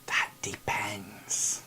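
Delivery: slow secretive whisper. Acted. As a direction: breathe it mysteriously. NPC whispering reply.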